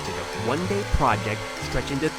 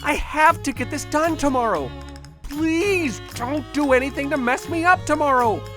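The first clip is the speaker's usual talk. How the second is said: exasperated outburst; 11 semitones higher than usual. Acted. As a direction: vent at the breaking point. Exasperated outburst at lab partner.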